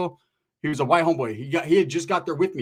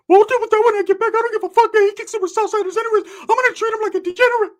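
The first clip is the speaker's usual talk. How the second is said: high, loud cartoonish rant; worked-up mock shouting; ranting cousin; 6 dB louder than usual. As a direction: imitate a fired-up cousin in squeaky falsetto. Acting out primo's angry rant.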